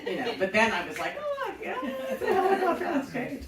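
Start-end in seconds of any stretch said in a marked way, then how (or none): 1.2-3.4 much higher, laughing, wide-eyed mock awe; mock naive wonder. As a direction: parody your starstruck younger self. Mocking her naive young self.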